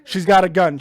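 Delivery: loud hammed-up alarm; dad faking panic to the camera; mock alarm. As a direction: cry out in fake panic. Fake alarm about his daughter.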